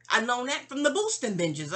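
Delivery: normal talking voice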